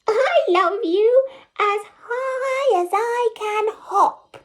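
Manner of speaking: high, gleeful hopping bunny; laughing little hare. Acted. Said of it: "laughed Little Nut Brown Hare".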